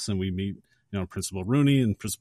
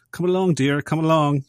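higher, louder put-on summons; Ferris; 6 semitones higher, 6 dB louder than usual. Ferris calls Sloane over outside the school, put-on summons. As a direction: call her over in a playful imitation.

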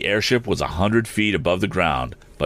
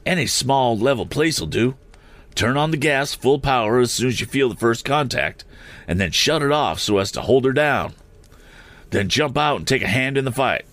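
higher-pitched brisk orders; mildly cried out; 7 semitones higher than usual. A narrator's reading. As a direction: give orders in a raised pitch, not shouted. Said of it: "cried Mister Sharp to Tom".

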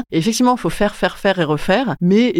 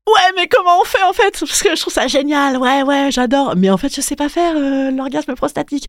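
higher, eager and clueless gushing; excited naive voice; 7 semitones higher than usual. Retold or imitated. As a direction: gush like a keen beginner. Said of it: "en disant".

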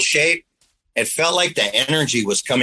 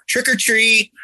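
put-on higher child's sing-song call; kid at the door; 11 semitones higher, slower than usual. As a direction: call it out like a trick-or-treater. Kids at the door.

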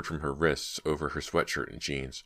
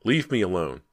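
frightened; 4 semitones higher, 5 dB louder than usual. A narrator's reading. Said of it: "he cried".

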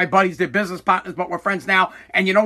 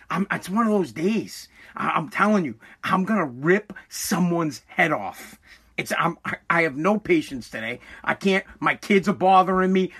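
quiet seething; 5 semitones lower, 6 dB quieter than usual. Real, not acted. Seething about his bad day.

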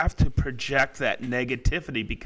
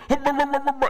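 grumpy hum; 11 semitones higher, much faster than usual. Retold or imitated. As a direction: hum it like a grumpy old man in the balcony. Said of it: "I go".

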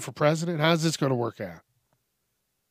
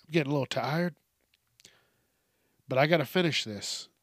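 ordinary delivery

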